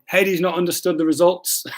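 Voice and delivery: ordinary talk, nothing marked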